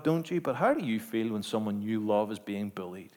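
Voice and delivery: usual speaking voice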